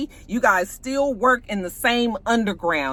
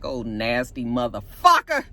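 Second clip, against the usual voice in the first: disgusted aside; 10 semitones lower than usual. Real, not acted. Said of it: "I was like".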